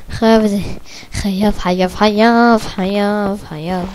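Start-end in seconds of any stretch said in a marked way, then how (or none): none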